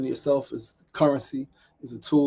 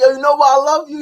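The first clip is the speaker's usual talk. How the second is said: high, loud tipsy gushing; imitated drunk woman; 11 semitones higher, 11 dB louder than usual. Drunk Janae to her old acquaintance, loud and effusive. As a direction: mimic a tipsy friend's loud affection. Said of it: "She's drunk".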